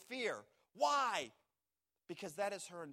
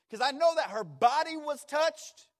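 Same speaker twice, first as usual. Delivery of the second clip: preaching at full force, higher and louder; fervent preaching, voice climbing; rising preacher; 9 semitones higher, 12 dB louder than usual. Acted. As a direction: lift the congregation with a louder, higher cry.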